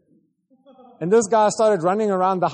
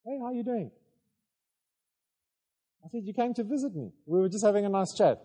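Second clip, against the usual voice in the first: higher and softer, easy and friendly; gentle reassurance; 4 semitones higher, 4 dB quieter than usual. Retold or imitated. Pastor gently soothing a confused man after deliverance. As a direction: put him at ease lightly.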